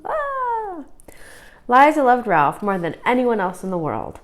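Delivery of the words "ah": high, soft startled yelp; a quick surprised ah; startled cry. Acted. Ball ruins birthday party.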